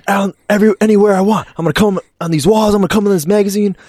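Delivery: high, loud hammed-up movie rant; Danny McBride. Actor's magazine-scene tirade at housemate, loud high mock fury. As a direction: rant defiantly, climbing to a shriek. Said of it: "Danny, Danny McBride".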